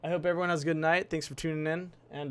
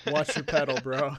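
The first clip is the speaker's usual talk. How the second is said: laughing; 4 dB louder, slower than usual. Real, not acted. Teasing line, laughing.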